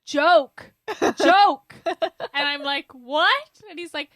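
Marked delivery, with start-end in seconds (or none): 0.1-1.8 loud, high exasperated shout; imitating an urgent correction. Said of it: "And Ferris just goes". 2.9-3.5 loud, high, baffled "what"; retold shock, pitched right up. Acting out her confused reaction.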